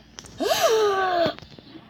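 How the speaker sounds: loud high gasp after holding breath; big gasp for air. Real, not acted. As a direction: gulp in air, high and sharp. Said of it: "hold my breath challenge".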